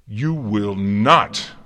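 slow, very loud command; forceful outburst. A narrator's reading. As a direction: thunder the refusal, every word drawn out. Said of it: "Celestia bit back the words".

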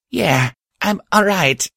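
out of breath, reassuring; breathless. Acted. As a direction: speak between breaths. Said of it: "I said breathlessly".

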